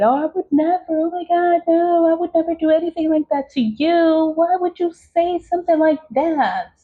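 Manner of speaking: high, sugary fake innocence; mock innocent denial. Mocking the senders who deny the curse, high fake innocence. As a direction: play the fake-innocent denier, pitched high. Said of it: "They're gonna deny it".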